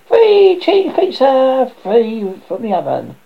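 high, drawn-out street vendor's call; venditore che chiama. Acted. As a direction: sing out loudly like a pizza seller. Boy hawking pizza in court.

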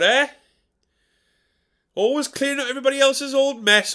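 high, whiny self-pitying moan; martyred grumbler. Acted. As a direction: sulk like a put-upon martyr. Old Dick Lips persona.

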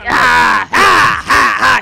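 loud mock pirate shouts; angry pirate grunts. Friend imitating Tori shoving through crowds, loud pirate grunts. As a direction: bark out gruff pirate noises while shoving. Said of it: "not like an angry pirate".

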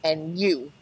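low, soft, knowing; lightly voiced medium singling him out; ominous pointing. Imitating the medium turning to her husband, low and ominous. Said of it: "she goes".

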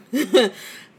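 a brief real chuckle at a memory; short chuckle. Real, not acted. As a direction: let a quick rueful laugh slip out. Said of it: "So that's what that chuckle was about".

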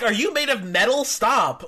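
high, laughing disbelief; mock incredulous protest. Sheik to an opponent who won't budge, mock incredulous. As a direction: imitate an exasperated fighter, pitched high. Voicing wrestler whose hits fail.

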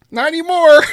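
high, loud comic squeal; mock squeal. Host answers puzzled friends, high-pitched mock squeal. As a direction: blurt it in a loud falsetto.

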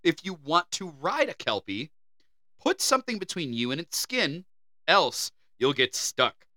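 ordinary delivery

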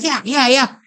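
a hint of eager hungry riders; eager cyclists. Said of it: "they'd be like".

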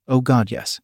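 plain reading voice

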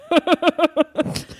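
high, staccato practiced laugh; put-on laugh. Shown as a demonstration. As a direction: demonstrate a fake, deliberately altered laugh. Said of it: "I changed my laugh and I'd go".

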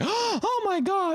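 high, drawn-out gasp of shock; gasp, then a long high "oh my God" in mock horror; imitating shocked morality ladies; scandalised ladies. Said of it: "morality ladies, of course, come out".

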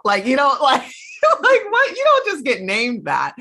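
squealing with disbelief; incredulous outburst. Real, not acted. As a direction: blurt it out, half laughing, can't believe it. Incredulous outburst about the dictator boss.

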